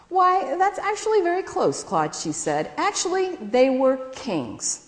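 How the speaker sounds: high, pleased surprise; mother pleased by Claude's answer, surprised. A narrator's reading. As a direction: sound delighted the kids got close. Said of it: "a little surprised and a little pleased".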